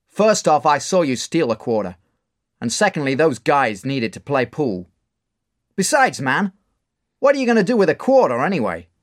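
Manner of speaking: bar owner. Acted. Mark scolding Dave over the quarter: loud rebuke. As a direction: tell him off mockingly.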